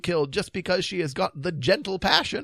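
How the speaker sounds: high-pitched, earnest dithering king; high voice of a fretful old king. Acted. King pleading for the lovesick beast, high and dithering.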